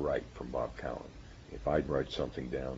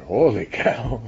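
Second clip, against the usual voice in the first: loud, high Holy cow in an imitated German accent; 9 semitones higher, 15 dB louder, much slower than usual. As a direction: ham up Hans Bethe's amazed German accent. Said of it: "in his German accent".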